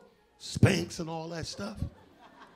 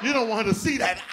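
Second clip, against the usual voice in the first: ordinary delivery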